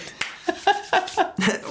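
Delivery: a high airy laugh, no voice; breathy laugh. Real, not acted. Host laughing before telling the story, airy laugh.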